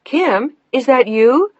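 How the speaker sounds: high, loud call with a questioning lift; calling out again. A narrator's reading. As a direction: call out to check who's there. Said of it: "But then she called out again".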